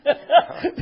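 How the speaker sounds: a short rising burst of laughter; bright laugh. Real, not acted. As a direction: let a real laugh escape. Laughs at the joy story.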